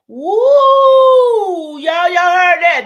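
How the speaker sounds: loud, high whoop of triumph; excited whoop. Real, not acted. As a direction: holler it out to the crowd. Cheering her own revelation.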